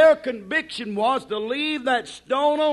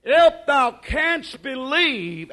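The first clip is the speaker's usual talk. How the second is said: ordinary talk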